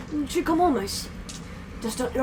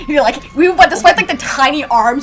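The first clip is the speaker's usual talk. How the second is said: straining; 3 semitones higher, 9 dB louder than usual. Acted. Narrating the strong handshake.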